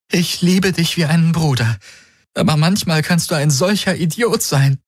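fond scolding through tears; lightly voiced tearful woman. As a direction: Kirai teasing Hawks. Kirai voiced by narrator.

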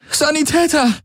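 high, urgent call for a medic. Acted. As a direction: cry out high for help. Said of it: "rief sie".

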